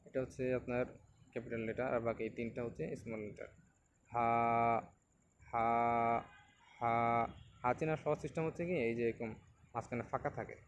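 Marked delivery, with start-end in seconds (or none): 4.1-6.9 হা held long on a level note; recitation demo. Shown as a demonstration. Teacher recites the letter forms, drawn-out ha. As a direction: draw out each হা evenly from the mouth. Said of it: "তো আমরা পড়ি".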